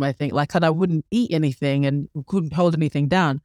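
ordinary talk

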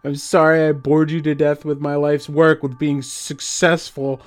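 bitter mock impression. A narrator's reading. Paul mocking his dead wife's voice, sarcastic. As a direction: mimic her cruelly to wound.

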